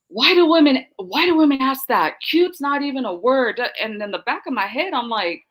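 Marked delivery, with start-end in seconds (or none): 0.1-3.6 retold yell. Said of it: "he literally yelled at me".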